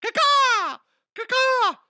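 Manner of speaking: loud, very high cawing; shrill crow call. Pretend. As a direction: caw like a crow on purpose. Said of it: "What's an owl sound like?".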